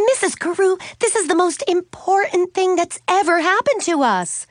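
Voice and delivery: high-pitched, dramatic insistence; aghast protest. A narrator's reading. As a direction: impress on a grown-up how huge this is. Suzanne protests to friend's mother.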